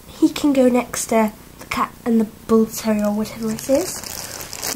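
ordinary delivery

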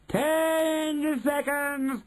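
high, long-drawn countdown call; very slow, high-pitched witch announcement; Grand High Witch crying out. A narrator's reading. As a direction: stretch the words out in a witchy cry. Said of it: "cried the Grand High Witch".

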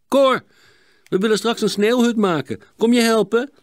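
high, loud, eager hail; calling out. Acted. Boy to friend about a snow hut, calling out. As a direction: call over to a friend. Boy calls out to neighbour's nephew.

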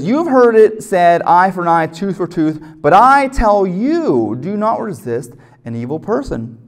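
slow "but I tell you", with the "I" leaned on hard; preacher punching "I" in "but I tell you"; stress on I. Shown as a demonstration. Preacher quoting Jesus, stressing I.

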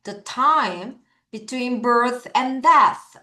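definition read very slowly, word by word. Shown as a demonstration. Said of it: "영영풀이 읽어봐요". (영영풀이를 아주 천천히 읽기)

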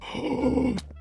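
high, soft, drawn-in gasp; startled gasp. Real, not acted. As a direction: suck in breath in alarm. Sudden fright in game.

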